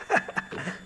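short, quiet chuckle; soft chuckle. Real, not acted. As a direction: chuckle softly in amusement. Amused at the ice cream story.

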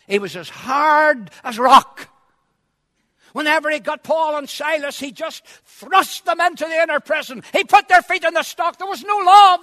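loud, high-pitched preacher's cry; impassioned shouted sermon; full-force preaching. Acted. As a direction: thunder it out from the pulpit, stretching key words.

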